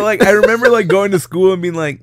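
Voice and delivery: ordinary delivery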